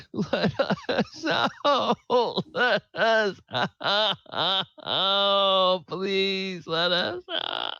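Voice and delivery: desperate sobbing cries from a captive; sobbing plea. Acted. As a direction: beg through hammed-up sobs. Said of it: "somebody sobbing and says".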